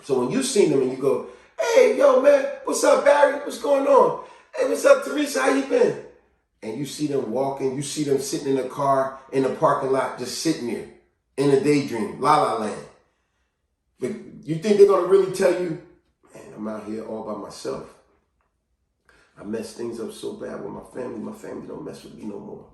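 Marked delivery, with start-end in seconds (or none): 1.6-6.0 high, bubbly hellos; cheery acted greeting, pitched well up; hearty greeting. Acting out greeting people by name. 16.3-22.7 low, very soft admission; subdued, downcast acting; lonely confession. Voicing lonely person's hidden confession.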